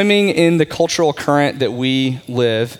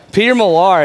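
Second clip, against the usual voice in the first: "Peter Millar" called out loud and high; loud echo; 6 semitones higher, 5 dB louder than usual. Shown as a demonstration. Pastor echoing a congregant's answer, loud and bright. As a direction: boom the shop name back to the room. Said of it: "just say it proudly".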